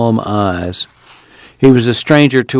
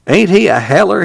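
fond; 9 semitones higher than usual. A narrator's reading. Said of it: "grinned affectionately".

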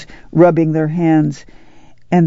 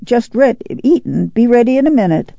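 high-pitched answering call; calling back; 7 semitones higher than usual. A narrator's reading. Neighbor answers Ma while eating, calling back. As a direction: call back from the other end.

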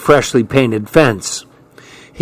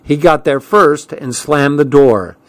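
ordinary delivery

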